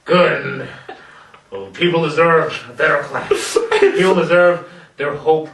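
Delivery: gravelly Batman impression, laughing. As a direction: growl it like a battered Batman. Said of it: "in a gravelly voice after being dropped".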